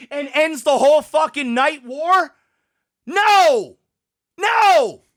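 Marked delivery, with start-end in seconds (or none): 3.1-5.0 two loud, drawn-out cries of protest; emphatic, exasperated outburst; outraged refusal. Real, not acted. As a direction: cry out in real, indignant refusal. Flatly rejects the ending.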